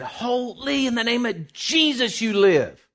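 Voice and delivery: high, forceful commanding cry; screamed prayer. Retold or imitated. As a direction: reenact a desperate prayer shouted over a newborn. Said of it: "I just screamed as loud as I could".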